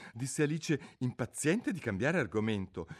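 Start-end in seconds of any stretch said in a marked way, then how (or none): none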